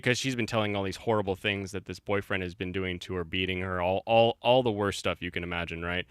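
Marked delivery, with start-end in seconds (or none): none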